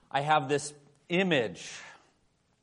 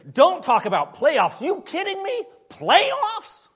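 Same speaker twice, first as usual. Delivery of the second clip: much higher and louder, hammed-up disbelief; incredulous coach rant; 14 semitones higher, 10 dB louder, slower than usual. Pastor imitating Jim Mora's press conference, mock outrage. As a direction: rant like a coach who can't believe the question. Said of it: "get it right here".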